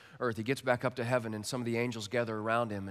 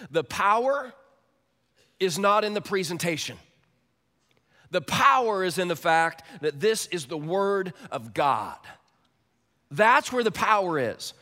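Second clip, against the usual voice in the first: preaching at full force; 6 semitones higher, 8 dB louder than usual. Real, not acted. Pastor to his congregation, loud preaching emphasis. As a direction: drive home that the power is God's word.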